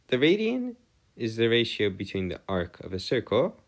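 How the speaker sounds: ordinary talk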